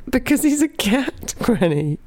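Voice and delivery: high squeak through laughter. Retold or imitated. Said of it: "I squeaked out".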